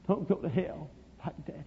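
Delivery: put-on very soft, tearful-child begging; small, faint, imploring voice; hushed plea. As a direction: beg Mama almost under the breath. Boy begs his mama, first time.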